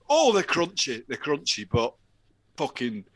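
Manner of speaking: high, loud mock despair over cereal; exasperated executive. Exec tasting cereal at board meeting, loud despair. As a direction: complain loudly that the milk's gone brown.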